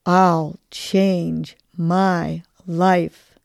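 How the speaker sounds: very slow, word by word; measured, drawn-out mantra; group affirmation. Shown as a demonstration. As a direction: stretch each word with space between. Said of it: "Big inhale again".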